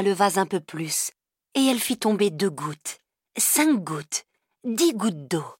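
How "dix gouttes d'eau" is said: plain reading voice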